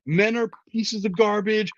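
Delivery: high, gushing mock excitement; gleeful hype. Fan recalling walking into the movie, mock-giddy. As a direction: play your earlier starry-eyed self, pitched well up. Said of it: "sunshine was shooting out of my eyeballs".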